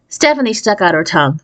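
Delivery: ordinary delivery, nothing marked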